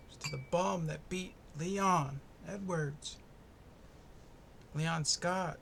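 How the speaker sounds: ordinary talk, nothing marked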